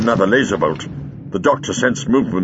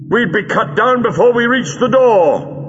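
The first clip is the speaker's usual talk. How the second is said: high, projected voice; raised, emphatic reply; urgent objection; 12 semitones higher, 3 dB louder than usual. Acted. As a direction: argue it urgently to companions. Doctor argues under fire.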